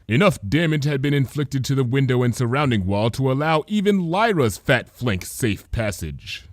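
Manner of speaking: plain reading voice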